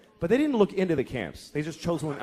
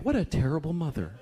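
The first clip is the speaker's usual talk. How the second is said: judgmental onlooker. Acted. Childless onlooker judging a mother, sanctimonious. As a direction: build into a lofty, preachy vow. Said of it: "And you think".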